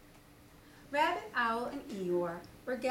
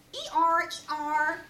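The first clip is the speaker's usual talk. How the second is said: loud braying hee-haw; donkey bray; 5 semitones higher, 8 dB louder than usual. Shown as a demonstration. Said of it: "what noise do donkeys make".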